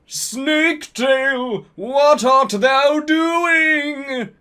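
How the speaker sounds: high, slow, hammed-up villain call; mock Voldemort. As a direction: ham up a shrill-high dark lord yelling. Voldemort calling out in story.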